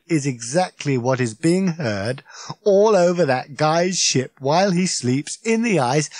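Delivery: Ford, gleeful. Acted. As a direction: savour the punchline.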